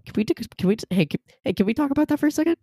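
high, fast, stammering outrage; outraged. Real, not acted. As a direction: sputter in disbelief. Outraged about bus seatbelts.

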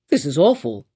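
a mild, lightly voiced cry of dismay; understated shocked official; cried governor. A narrator's reading. Governor shocked by the child, mild cry. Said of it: "cried the governor".

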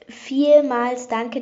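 "Vielmals" stretched, slow and loud; slow emphatic thanks. Retold or imitated. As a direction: draw out "Vielmals danke" slowly and loudly. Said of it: "dass du die letzte Nachricht im Podcast vorgelesen hast".

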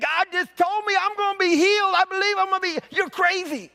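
high, fervent declaration; excited insistence. Acted. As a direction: proclaim the healing with fire. Bud insists he'll be healed.

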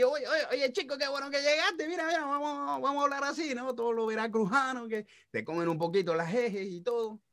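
put-on high, lively Veracruz coastal accent swallowing the s; chatty Mexican coast Caribbean-leaning accent. A coastal Mexican guy greets a friend, Veracruz accent. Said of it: "te hablan así".

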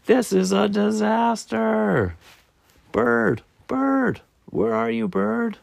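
high-pitched squirrel wail; panicked Squirrel. Acted. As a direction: call out high and frantic, stretching the words. Squirrel panics and calls for Bird.

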